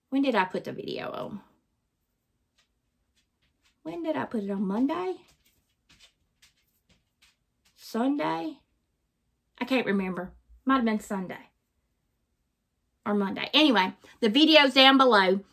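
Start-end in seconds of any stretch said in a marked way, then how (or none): 0.1-1.4 soft, quick question muttered to herself; quiet muttering. Real, not acted. Vlogger trying to recall the upload day, muttering. Wondering aloud when she posted. 3.8-13.5 soft, unsure thinking aloud; half-heard musing, not meant for the viewers; muttering to herself. Real, not acted. Vlogger guessing which day she posted, softly muttering. Trying to recall the upload day.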